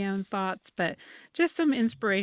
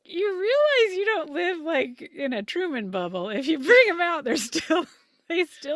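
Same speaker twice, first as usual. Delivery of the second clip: much higher and louder, incredulous; high, loud, disbelieving talk to the squirrel-trapping neighbor; mock exasperated lecture; 10 semitones higher, 6 dB louder than usual. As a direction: lecture the neighbor like he's clueless. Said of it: "And I'm like".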